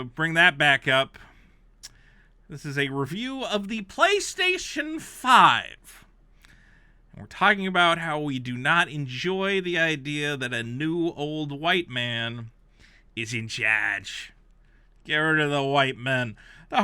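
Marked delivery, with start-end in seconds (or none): 2.5-6.0 slow, loud, stretched PlayStation; emphatic reminder. Real, not acted. Reminding listeners what this is, slow emphatic. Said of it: "I'd just like to remind you of that". 10.6-14.3 slow mock read. Real, not acted. Mocking the review's phrase, slow drawn-out read.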